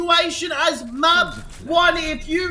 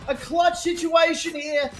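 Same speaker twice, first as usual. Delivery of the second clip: ordinary talk, nothing marked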